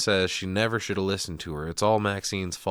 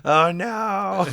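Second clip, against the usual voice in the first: higher, louder playful dismay, building to a wail; mock horror; 8 semitones higher, 6 dB louder, slower than usual. Real, not acted. Co-host reacts to Maxine trapped under the bed, in mock horror. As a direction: recoil in escalating exaggerated disgust.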